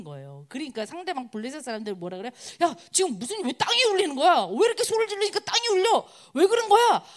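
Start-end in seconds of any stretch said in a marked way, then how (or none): 2.6-7.0 high-pitched, rattled enemy soldiers; acted alarm. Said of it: "막 난리가 난 겁니다". (당황한 적군을 높은 목소리로 흉내)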